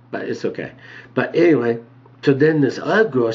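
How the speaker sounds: ordinary talk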